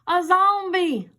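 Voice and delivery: high, loud cry of alarm; mock horrified shout; crowd cry. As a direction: scream it like a startled crowd. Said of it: "a crowd cried with horrific screams".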